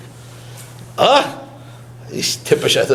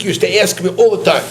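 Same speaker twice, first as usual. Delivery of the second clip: ordinary talk, nothing marked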